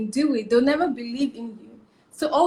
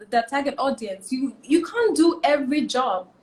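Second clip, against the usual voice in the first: ordinary delivery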